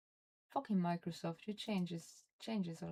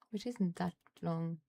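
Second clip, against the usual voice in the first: usual speaking voice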